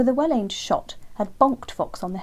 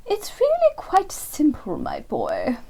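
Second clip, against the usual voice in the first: put-on high, slow, lofty old owl voice; superior owl; 8 semitones higher, 5 dB louder, slower than usual. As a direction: talk down to the boy from a great height. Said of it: "so as to assert her superiority".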